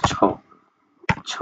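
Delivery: "ছ" sounded alone as ch; demonstrated CH sound. Shown as a demonstration. Teacher showing students the affricate, isolated "ছ". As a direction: sound out "ছ" twice with a long pause between. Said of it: "সেটা হচ্ছে".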